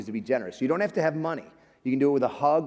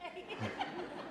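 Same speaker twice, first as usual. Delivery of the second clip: soft, unvoiced little laugh; breathy chuckle; 15 dB quieter than usual. Real, not acted. Pastor laughing about his sister being loved more, breathy chuckle. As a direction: laugh at your own joke.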